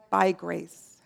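low, soft and reverent; quiet, low payoff to a question; hushed answer. Real, not acted. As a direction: drop well down to answer your own question.

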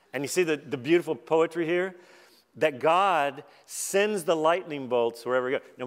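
ordinary delivery

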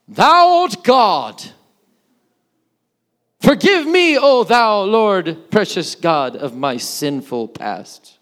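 booming, slow, grandiose prayer; mock-solemn prayer, drawn out and very loud; formal praying voice. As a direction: declaim it loudly like an overblown old-time prayer. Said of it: "Why do you talk to me totally different".

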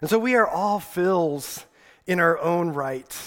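ordinary talk, nothing marked

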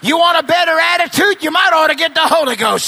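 shouted, rising gospel preaching, slowing on the big lines; preacher pounding it home to the church; preaching at full force. Acted. Preacher to the congregation, preaching at full shout.

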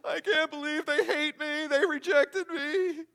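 hammed-up wail, high and loud; mock devastated waiter. Preacher mocks a waiter sobbing over a refused refill. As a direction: overact the heartbroken waiter. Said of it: "do they ever go".

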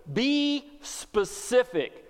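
drawn out, each word weighted; slow it right down to press the point; slow emphasis. Real, not acted. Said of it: "emphatic exhortation".

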